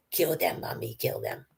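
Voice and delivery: put-on hushed, breathy child-like whisper; whispered mock horror line; creepy whisper. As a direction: whisper it like a creepy little kid. Eerie quote, creepy child voice.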